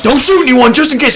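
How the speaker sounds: nervous self-warning. Retold or imitated. Hiker warning himself holding his gun, jittery. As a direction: warn yourself, jittery.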